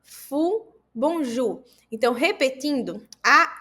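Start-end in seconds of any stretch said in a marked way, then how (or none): 0.0-1.6 fou and bonjour with a clear u; teacher models the u sound; ou sound demo. Shown as a demonstration. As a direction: round the ou into u. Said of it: "Veja que o som é de U".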